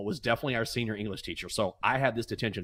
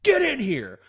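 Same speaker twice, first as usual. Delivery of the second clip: stern order; 11 semitones higher, 5 dB louder, slower than usual. Retold or imitated. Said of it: "He goes".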